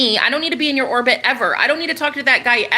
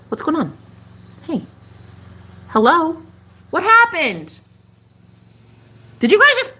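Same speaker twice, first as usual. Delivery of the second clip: high, worried calling; alarmed; 6 semitones higher than usual. Real, not acted. Calling to the moth that suddenly stopped moving. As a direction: call out in disbelief.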